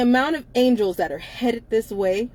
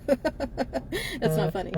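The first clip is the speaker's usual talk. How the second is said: laughing; 4 semitones higher, 6 dB quieter, much slower than usual. Real, not acted. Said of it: "It's my way of coping".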